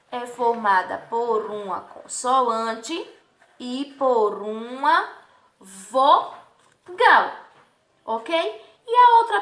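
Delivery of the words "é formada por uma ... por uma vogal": slow, with "consoante" stretched and "vogal" split as vo-gal; drawn-out answer. Shown as a demonstration. Teacher explaining how xó is formed, "consoante" and "vogal" drawn out. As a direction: pause before "vogal" and break it in two.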